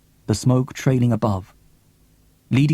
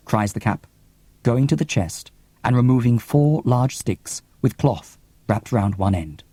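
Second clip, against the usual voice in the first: ordinary talk, nothing marked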